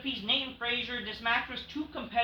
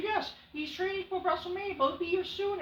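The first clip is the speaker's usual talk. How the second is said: high, light Heyman voice; a hint of the smug manager; mock reassuring Heyman; imitating Heyman; 11 semitones higher than usual. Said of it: "And then Paul said".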